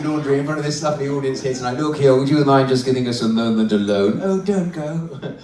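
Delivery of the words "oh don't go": slow, pleading wife puppet; drawn-out feminine plea. Acted. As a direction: beg as the lady dummy. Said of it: "let's find a nice feminine voice".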